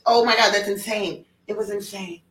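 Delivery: usual speaking voice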